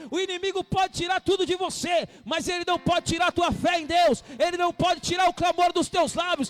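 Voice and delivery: shouting, fervent sermon climax; rally the congregation with a loud call; full-force preaching. Acted. (pregação inflamada aos gritos)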